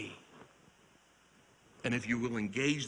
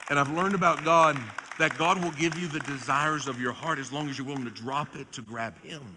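louder, rising sermon cadence with a fast, louder repeat; preaching climax; 3 semitones higher, 7 dB louder than usual. Acted. Preacher closing the story for the congregation, rising fervor. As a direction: drive the lesson home.

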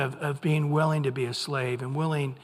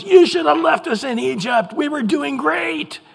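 much higher, louder mock whine; whining at Moses; 9 semitones higher, 5 dB louder than usual. Israelites turning on Moses, high mock whine. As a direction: sneer it up at Moses. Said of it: "There's Moses".

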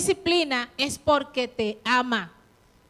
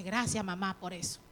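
grateful thanks; 6 semitones lower, 10 dB quieter than usual. Retold or imitated. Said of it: "Pero hoy usted dice".

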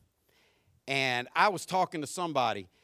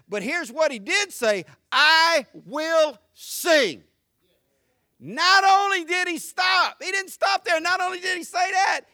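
high, raised preacher's voice building to a shout; full-force preaching; 10 semitones higher, 7 dB louder than usual. Real, not acted. Preacher urging the congregation to sing, full-force preaching. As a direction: drive it home to a loud climax.

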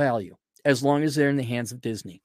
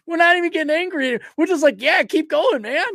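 laughing; 13 semitones higher, 3 dB louder than usual. Real, not acted. Laughing mock cheer.

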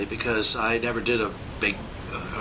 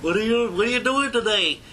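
high, loud yokel voice; a bumbling country bird; dopey yokel Big Bird; 10 semitones higher, 4 dB louder than usual. Retold or imitated. Early Big Bird voice, loud yokel. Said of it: "So instead of sounding like".

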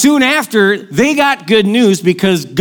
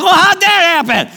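mock surprise; 10 semitones higher than usual. Surprised at pregnancy news.

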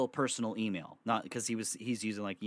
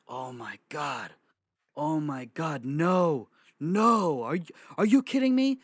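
mock shock; 5 semitones higher, 6 dB louder than usual. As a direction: act out his stunned reaction, climbing in pitch. Acts out man's shocked reaction.

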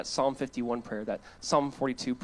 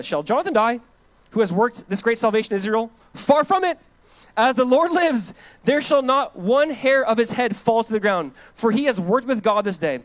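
raised, urgent protest; loud pleading crowd; 6 semitones higher, 7 dB louder than usual. Acted. People protest to Saul to spare Jonathan, loud and urgent. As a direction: people shout down the king's vow.

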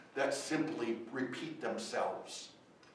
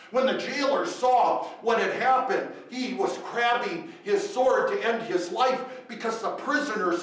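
full-force preaching; 7 semitones higher, 10 dB louder than usual. Acted. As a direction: drive each question home to the congregation. Preacher builds to miracle climax.

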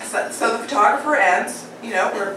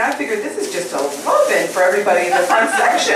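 ordinary delivery

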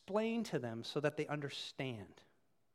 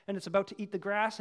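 normal talking voice

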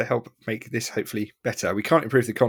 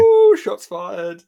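teasing; 9 semitones higher, 7 dB louder, much slower than usual. Real, not acted. Teasing reaction.

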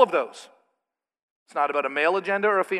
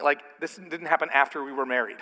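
ordinary talk, nothing marked

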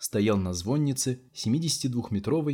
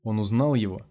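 usual speaking voice

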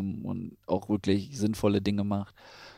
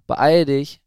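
loud, raised hurry-up shout; urgent call; 5 semitones higher, 11 dB louder than usual. A narrator's reading. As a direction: call to someone lagging behind. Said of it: "hörte sie den anderen rufen".

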